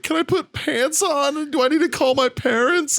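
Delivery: whiny high-pitched parody of sobbing; imitated crying plea. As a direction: plead like a terrified man in tears. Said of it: "crying and shit".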